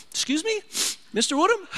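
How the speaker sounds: high, lightly mocking instructor. Instructor interrupting a crying student, high mock voice. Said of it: "my instructor was like".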